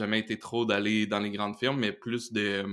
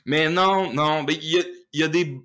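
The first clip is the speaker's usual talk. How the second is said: emphatic; 5 semitones higher, 5 dB louder than usual. Real, not acted. Laughing, emphatic aside.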